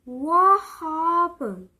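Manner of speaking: slow, loud, drawn-out meme voice; loud slowed-down meme what happened; what happened sound effect. Retold or imitated. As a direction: stretch it out like the meme sound clip. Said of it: "you know that "what happened" sound effect?".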